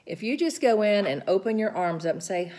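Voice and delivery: normal talking voice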